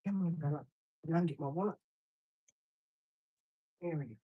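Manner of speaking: calling the dog from across the room; far off. Real, not acted. Walking away with dog.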